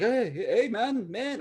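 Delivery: put-on Aussie accent drifting toward Jamaican; failed Aussie accent. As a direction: have a clumsy go at an Aussie accent. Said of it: "I can't even do an Aussie".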